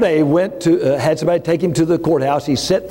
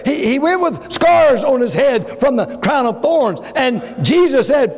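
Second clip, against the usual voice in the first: full-force preaching; 9 semitones higher, slower than usual. Acted. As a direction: preach it hard to the congregation.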